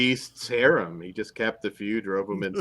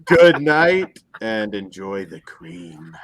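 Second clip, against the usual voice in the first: put-on sign-off voice; 7 dB louder, much slower than usual. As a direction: ham up a drawn-out show sign-off. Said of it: "whole show in that voice".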